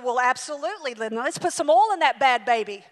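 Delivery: cheerfully ready to pay; mock eager. Eager quote, loud.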